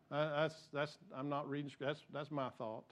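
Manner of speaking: quiet aside. Real, not acted. Aside, not scripted.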